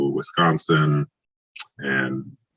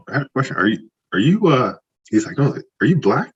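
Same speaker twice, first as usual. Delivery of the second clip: higher, hesitant coach voice; imitating the coach; 9 semitones higher than usual. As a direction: mimic the awkward question.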